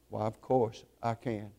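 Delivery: a quiet smile in the voice as she gives in; understanding smile. A narrator's reading. Mother to the pastor, soft and smiling. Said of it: "With an understanding smile".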